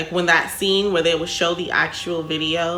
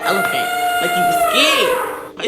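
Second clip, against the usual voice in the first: high squeal; 4 dB louder than usual. Real, not acted. Stressing how scary.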